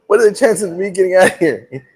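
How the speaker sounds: asked through a laugh; giggling plea to the nurse; laughing question. Retold or imitated. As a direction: laugh while asking about the odds. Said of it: "I go".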